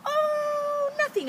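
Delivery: put-on high innocent child's voice; innocent little girl. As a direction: squeak it out like a girl hiding something. Girl voiced in read story.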